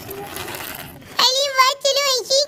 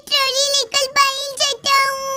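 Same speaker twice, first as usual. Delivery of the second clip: ordinary talk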